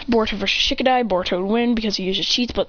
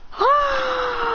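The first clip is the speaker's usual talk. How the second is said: high crying ah; high anime effort cry; high strained yell; 14 semitones higher than usual. Acted. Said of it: "They go".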